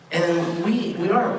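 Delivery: usual speaking voice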